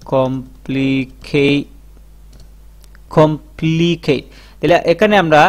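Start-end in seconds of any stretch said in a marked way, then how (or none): 0.1-4.2 "complicate" drawn out slowly as kom-pli-kei; slow syllable-by-syllable. Shown as a demonstration. Teacher showing viewers a long word, slow and syllabic. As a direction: stretch each syllable of "complicate". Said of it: "তিনটি ভাগে ভাগ করে".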